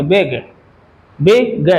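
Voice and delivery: बिग broken as बि, then ग; two syllables. Shown as a demonstration. Teacher models for students how to say बिग, syllable by syllable. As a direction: sound out बिग in two beats. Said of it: "जब भी बोलेंगे ऐसे बोलेंगे".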